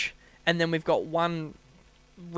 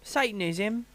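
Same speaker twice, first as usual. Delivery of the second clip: South London "Satanism", pitched up; South London accent; 4 semitones higher, slower than usual. Shown as a demonstration. Cohost practising the word, South London accent. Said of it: "South London".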